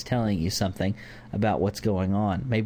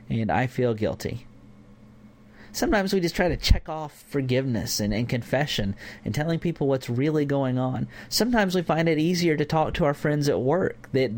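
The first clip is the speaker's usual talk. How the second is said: usual speaking voice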